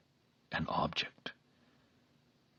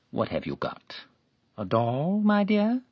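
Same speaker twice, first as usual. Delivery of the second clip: coaxing; 10 semitones higher, 6 dB louder than usual. A narrator's reading. Man coaxing the girl.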